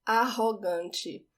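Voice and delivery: Arrogante with the double R as a breathy h sound; Arrogante, ahogante, clear and slow; Portuguese pronunciation. Shown as a demonstration. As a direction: say Arrogante slowly, rr like English h. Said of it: "The double R in Portuguese sounds like the age in English".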